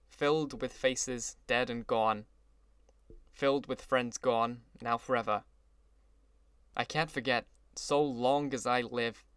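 ordinary delivery, nothing marked

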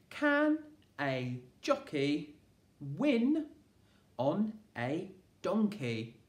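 a teacher dictating a sentence slowly; slow dictation. Shown as a demonstration. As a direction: dictate it word by word for children to count. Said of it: "how many words that was".

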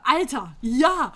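a loud, emphatic Alter, ja; loud exclamation. Real, not acted. Quiz answered aloud to viewers, with a loud defiant Alter, ja. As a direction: belt out the answer with defiant gusto. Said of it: "Wer schon mit Alter ja antwortet".

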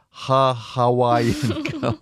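slowed, breaking into laughter mid-word; can't get the name out for giggling; laughing through name. Real, not acted. Host reading a listener's name, cracking up with laughter. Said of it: "I can't, I'm sorry".

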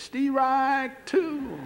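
very high, long drawn-out call; umpire's strike call. Retold or imitated. Rookie umpire bluffing a pitch he missed, high drawn-out strike call.

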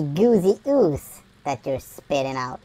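ordinary delivery

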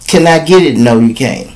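usual speaking voice

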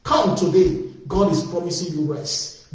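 usual speaking voice

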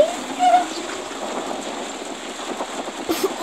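high unvoiced giggle; breathy laugh. Real, not acted. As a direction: laugh it off in disbelief. Laughs after question.

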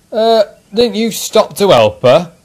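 high, loud suspicious policeman voice; suspicious questioning. A narrator's reading. Policeman interviews the engine, questioning suspiciously. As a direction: question the witness with open suspicion. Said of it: "questioned the policeman suspiciously".

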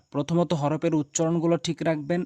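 ordinary delivery

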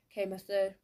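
ordinary delivery

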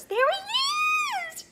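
put-on very high, loud, slow girlish squeal; young girl spotting the famous swan, screaming with delight. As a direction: shriek with delight. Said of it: "she screamed".

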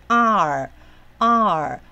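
"R" drawn out slowly twice, then "artist" in two beats; syllable built up. Shown as a demonstration. Teacher attaching the consonant to the syllable before, slow drawn-out "R", then "artist". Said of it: "把它归到前面的子音".

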